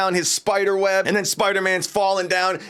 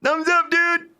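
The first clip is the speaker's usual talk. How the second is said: much higher, cartoonish thumbs up; goofy cheer; 11 semitones higher, slower than usual. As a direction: chirp it out like a dopey hero. Trapped Spider-Man cheerfully accepting.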